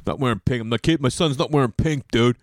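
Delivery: lightly voiced dismissive bro; imitated macho dude voice; mocking dudes. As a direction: put on a bro guy balking at the idea. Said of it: "how dudes are like".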